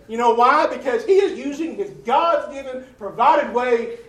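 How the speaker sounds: normal talking voice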